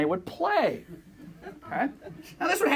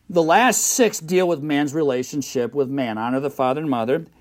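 loud, fervent sermon voice, much louder; full-force preaching; 5 semitones lower, 9 dB louder than usual. Acted. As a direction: preach it out to the congregation. Pastor reciting commandments loudly.

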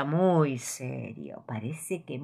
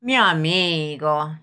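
fox voice; 6 dB louder, much slower than usual. Acted. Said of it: "le dice el zorro".

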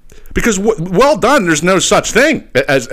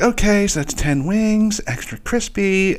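higher, slow, drawn-out order taker; phone order girl; 7 semitones higher, 5 dB quieter, slower than usual. Retold or imitated. Girl on the phone reading back a wings order, higher slow mimicry. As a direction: read the order back slowly in a higher, softer voice.

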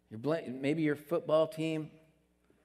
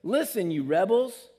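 angry Moses; 4 semitones higher, 6 dB louder than usual. Retold or imitated. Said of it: "Moses spoke to them and said".

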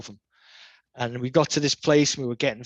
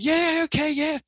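much higher mock-breezy voice; acting all fresh; 14 semitones higher than usual. Skaters coming out acting fresh, mock breezy high voice. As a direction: mimic someone pretending all is fine. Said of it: "acting all fresh".

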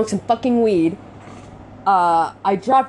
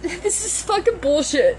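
breaking into tears; 9 semitones higher than usual. Real, not acted. Venting about feeling defeated.

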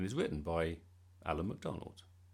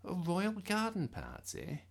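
amazed; 11 semitones higher than usual. A narrator's reading. Mum amazed.

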